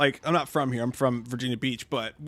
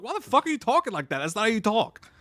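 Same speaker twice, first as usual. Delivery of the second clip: self-scolding; 7 semitones higher than usual. Retold or imitated. Retold self-scolding after the accent.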